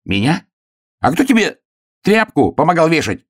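high, incredulous protest; squeaky affronted hare; indignant hare. A narrator's reading. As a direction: rise high in wounded disbelief. Hare outraged at dismissal.

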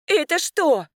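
high-pitched cry of alarm; startled cry. A narrator's reading. Sister sees barking dogs approaching, startled cry. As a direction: cry out at a sudden sight. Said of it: "вскрикнула она".

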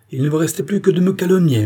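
high, loud wounded protest; indignant outcry. A narrator's reading. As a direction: cry out at the insult. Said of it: "s'écria la reine".

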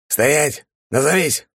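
high, sharp shouted halt; shouted challenge. A narrator's reading. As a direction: call out a sentry's halt. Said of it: "Короткий окрик".

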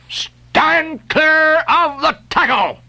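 high, slow, booming warning cry; a voice crying a warning to the crew; bursting cry. A narrator's reading. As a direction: burst out like a rocket. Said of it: "cried a voice like the bursting of a rocket".

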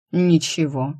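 emotionless, indifferent reply. A narrator's reading. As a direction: say it with no feeling at all. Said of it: "безразлично ответил повелитель".

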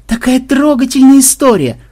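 high, loud sentimental gush. A narrator's reading. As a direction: coo over a touching story. Gushing over the touching story. (умилённо, высоко и громко)

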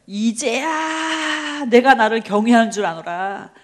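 long-drawn, loud divine pronouncement; booming, very slow proclamation; God's solemn declaration. Acted. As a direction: stretch the first word out grandly as God speaking. God's verdict, acted solemnly.

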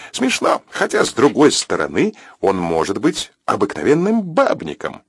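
perky on-air patter, drawing out the teasing reveal; radio presenter on air. Acted. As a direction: voice a radio host sharing gossip.

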